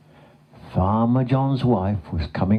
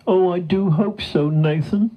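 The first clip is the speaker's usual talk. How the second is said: high storybook cow voice; anxious cow; 10 semitones higher than usual. A narrator's reading. Stuck cow to her rescuer, anxious hope. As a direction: fret hopefully in a pitched-up voice.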